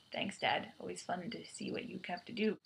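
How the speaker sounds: soft, muttered sarcasm; a dry under-the-breath jab; sarcastic. Retold or imitated. Nebula mocking her father Thanos, quiet and sarcastic. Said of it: "sarcastic goes".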